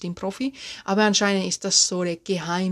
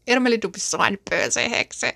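shocked gasp, then higher scolding in a put-on voice; mock outrage; 5 semitones higher than usual. Scandalized listener scolding Irmeli as a böse Hexe, mock outrage. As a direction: play the scandalized accuser.

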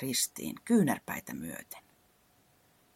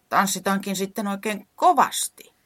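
louder, stressed words; emphatic; 7 semitones higher, 7 dB louder than usual. Acted. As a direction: say it with firm emphasis. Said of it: "sanoi painokkaasti".

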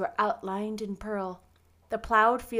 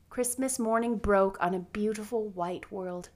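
usual speaking voice

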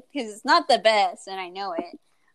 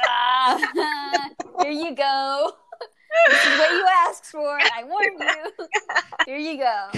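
laughing half-cry; 5 semitones higher, 4 dB louder, slower than usual. Retold or imitated. Handing over bad bread, quoted.

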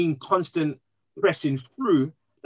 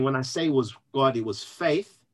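usual speaking voice

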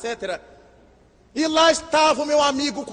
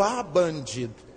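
slow, softer scandalised mutter; shocked at the widower's new woman, soft indignant mutter; muttered indignation; 7 semitones lower, 6 dB quieter than usual. Retold or imitated. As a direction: grumble it under your breath in shock. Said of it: "E eu tive um choque".

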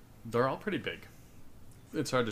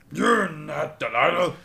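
slow, booming ogre growl; 6 semitones higher, 10 dB louder than usual. Acted. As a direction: rumble it like a puzzled giant. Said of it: "and just goes".

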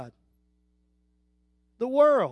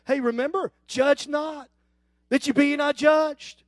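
smug mock; 6 semitones higher, 5 dB louder than usual. Church mocked as smug.

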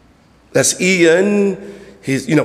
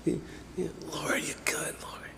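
soft, half-whispered praise; mock timid praise; 11 dB quieter than usual. Shown as a demonstration. Preacher demonstrates praising the Lord too quietly, half-whispered. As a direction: show the wrong way, barely audible. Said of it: "You don't praise the Lord like this".

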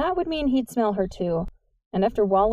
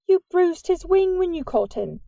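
lightly accusing, pitched up; note of accusation; 9 semitones higher than usual. A narrator's reading. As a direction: point out his fault, a touch reproachful. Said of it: "with a note of accusation".

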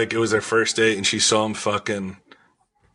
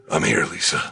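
deep Batman voice; 7 semitones lower than usual. Shown as a demonstration. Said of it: "do the voice".

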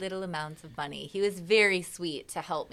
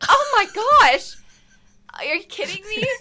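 very high, loud retold exclamation; outraged disbelief; 10 dB louder than usual. Singer reacting to the unrecorded take, shrill disbelief. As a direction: shriek it in dramatised dismay.

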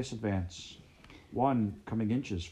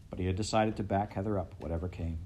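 ordinary delivery, nothing marked

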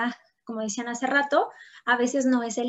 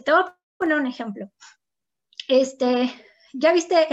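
put-on dignified kid; 5 dB louder than usual. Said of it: "bien digno el niño".

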